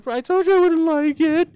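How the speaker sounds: retelling Rachel breaking into tears; mock crying. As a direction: blubber it like a weepy sitcom moment. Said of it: "she starts crying".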